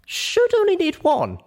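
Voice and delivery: put-on high, eager young pony voice; small mare walking friends through a rescue; young Littlepip explaining. Littlepip answers confidently.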